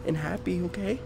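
soft, careful coaxing voice; soothing a dangerous creature quietly; hushed coaxing. Acted. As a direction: tiptoe in with your voice. Gently approaching scary little brother.

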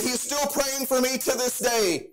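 high, ringing preacher's cadence over music; fervent preaching. Acted. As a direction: lift the congregation, preach it out. Preaching at full force over music.

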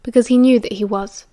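ordinary delivery

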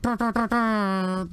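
high, drawn-out horn blasts; imitated air horn. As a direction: mimic an NBA air horn with your voice. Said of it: "as loud as an air horn".

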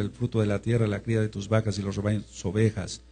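normal talking voice

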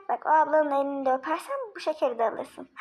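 ordinary talk, nothing marked

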